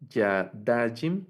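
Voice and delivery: "yadajim" very slowly, stressed on "da"; penultimate stress. Shown as a demonstration. Teacher giving dual examples, "yadajim" stressed on the second-to-last syllable "da". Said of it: "el acento va en la penúltima sílaba".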